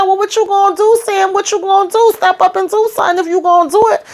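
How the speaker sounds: high mocking challenge; high taunting dare. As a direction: egg him on to step up. Narrator daring Sam to act.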